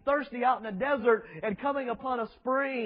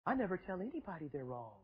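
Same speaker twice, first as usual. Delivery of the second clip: mock soft preacher; 4 semitones lower, 12 dB quieter than usual. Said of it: "It's a surrender to the wicked".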